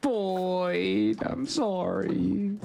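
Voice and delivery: mock tearful apology. Apologising to lost character.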